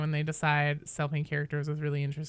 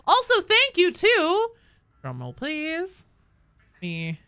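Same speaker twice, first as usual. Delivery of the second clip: dramatic buildup; 10 semitones higher, 5 dB louder, slower than usual. Pretend. Building up to self-thanks.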